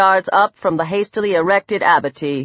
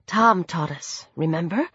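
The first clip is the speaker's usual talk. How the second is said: hushed, unhurried prompting; said quietly; 4 semitones lower, 6 dB quieter than usual. A narrator's reading. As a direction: quietly remind them, voice dropped. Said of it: "she said quietly".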